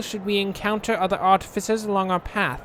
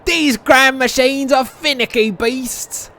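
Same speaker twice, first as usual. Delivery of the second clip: put-on slow, louder thick Yorkshire accent; slow Yorkshire grumble; 5 semitones higher, 5 dB louder, slower than usual. A narrator's reading. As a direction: drag it out in broad Yorkshire. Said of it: "in a thick Yorkshire accent".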